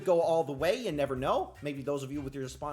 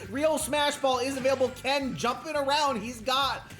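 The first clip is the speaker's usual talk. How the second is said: raised, urgent sports-commentator voice; excited play-by-play; 6 semitones higher, 5 dB louder than usual. Real, not acted. Commentator urging on a passive Yoshi, excited play-by-play. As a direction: call the fight live, building to louder exclamations.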